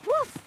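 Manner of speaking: two high little woofs; puppy woof. Acted. Puppy answers a child calling it over, high woofs.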